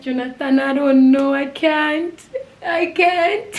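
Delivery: loud, high re-enacted wail; acted desperate plea. Re-enacts breaking down before brother.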